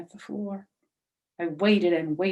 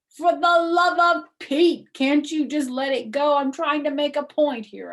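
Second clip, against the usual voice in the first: exasperated outburst; 10 semitones higher, 12 dB louder than usual. Acted. Junie explodes at the teacher, loud and exasperated. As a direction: throw your head back and wail it.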